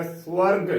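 "ਸਵਰਗ" filled out as "swarg"; full-voiced pronunciation. Shown as a demonstration. Teacher models the word for learners, "ਸਵਰਗ" full as "swarg". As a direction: say "ਸਵਰਗ" full, s running straight into w. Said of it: "ਭਰ ਕੇ ਬੋਲਣਾ ਹੈ".

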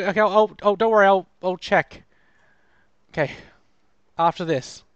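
ordinary delivery

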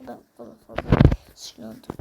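deep joke voice. Pretend. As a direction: growl it in a fake voice. Deep put-on grumble.

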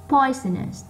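POI in "poisonous" high, then falling; stressed first syllable. Shown as a demonstration. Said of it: "nó to hơn những âm còn lại". (nhấn mạnh âm một của "poisonous")

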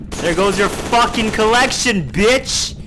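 high, loud gamer trash talk; loud taunt. Real, not acted. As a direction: crow it loudly at the enemy. Taunting while playing a game.

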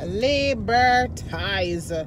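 very slow, stretched "Liberties"; drawn out. Real, not acted. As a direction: drag out "Liberties" slowly. Drawn-out repeat.

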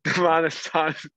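words broken by a laugh. Real, not acted. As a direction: giggle mid-sentence. Laughing at teammates' antics.

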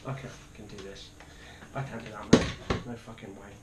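very soft, low voice; real shaken, hushed refusal; defeated refusal. Real, not acted. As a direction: give up almost under your breath. Shaken player giving up.

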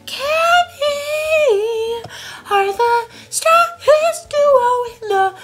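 very high, slow cartoonish boast; high squeaky voice. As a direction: squeak out the boast like a tiny character.

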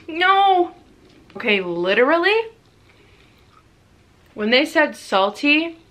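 talking between bites; chewing while talking. Real, not acted. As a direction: mouth partly full. Seen 1: cheek bulge and closed-lip chewing between lines; hand to mouth.

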